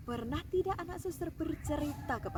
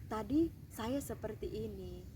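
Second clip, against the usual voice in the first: put-on quiet, lower child voice admitting to mama; hushed little confession; child confessing; 4 semitones lower, 8 dB quieter than usual. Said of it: "anak suster perlu cerita". (anak yang lirih mengaku kepada mama)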